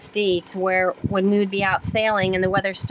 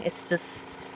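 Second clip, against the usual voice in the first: near-whispered aside; 2 semitones lower, 7 dB quieter than usual. Real, not acted. Soft trailing phrase.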